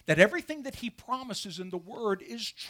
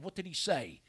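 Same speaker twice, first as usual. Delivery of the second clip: normal talking voice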